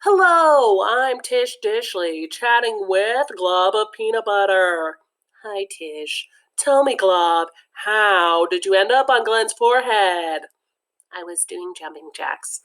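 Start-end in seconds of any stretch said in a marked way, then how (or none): 0.0-5.0 talk show host. Acted. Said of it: "Time for another edition of Celebrity Interview". 11.1-12.6 hushed whisper for the glob; whispered tiny voice. Acted. Peanut butter glob answers Tish.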